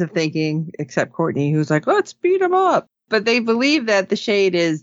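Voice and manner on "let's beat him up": high-pitched, gung-ho imitation; mock eagerness. Courtney urges the team to fight, imitated eager cry. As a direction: play the keen fighter among cautious teammates.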